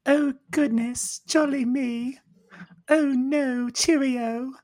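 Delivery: put-on high, prim British lady accent; British lady impression. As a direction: play it as a proper English lady. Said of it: "I'm going to imitate her".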